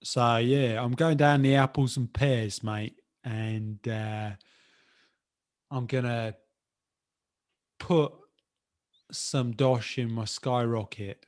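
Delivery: second go at a Cockney accent, halting. Shown as a demonstration. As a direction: put on Cockney with pauses to find the words. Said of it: "can I have another go?".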